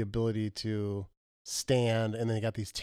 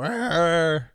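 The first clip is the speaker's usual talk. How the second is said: mock growl; 5 semitones higher, 5 dB louder than usual. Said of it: "Don't you go".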